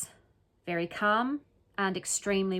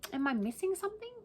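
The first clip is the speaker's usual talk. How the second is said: higher, softer repeat of the question; incredulous; 8 semitones higher, 5 dB quieter, faster than usual. Shown as a demonstration. Vlogger asking viewers again, incredulous and higher. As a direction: ask it again as if truly baffled.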